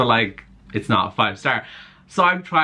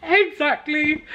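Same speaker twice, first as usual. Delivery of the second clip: high, drawn-out squeal of exactly; delighted exclaim; slower than usual. Real, not acted. Reader squealing at a line in her book.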